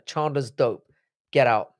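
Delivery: usual speaking voice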